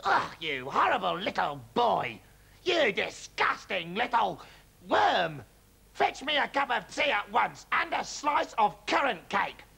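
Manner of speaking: put-on slow, sneering old hag voice; grandma sneering at the boy for tea; nasty old grandma. A narrator's reading. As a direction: spit the insults out slowly. Grandma scolding George.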